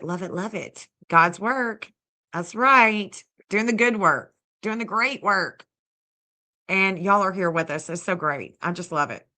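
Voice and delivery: ordinary talk, nothing marked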